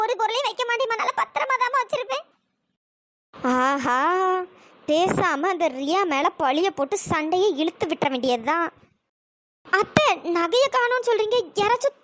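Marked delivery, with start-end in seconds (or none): 3.6-8.7 low-pitched, plotting inner voice; lowered, calculating musing to yourself; scheming aside. Acted. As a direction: relish a plan to shift blame.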